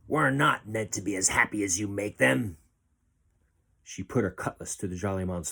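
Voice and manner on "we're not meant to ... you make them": cold sneer of a pirate captain. A narrator's reading. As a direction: scorn the captive's gift of joy. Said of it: "she sneered".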